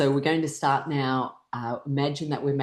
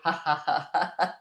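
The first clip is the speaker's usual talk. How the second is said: breath pushed out in a string of ha-has; trailing exercise laugh; demonstrated breathing laugh. Shown as a demonstration. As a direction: laugh out the out-breath while bending forward. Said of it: "and I was going forward".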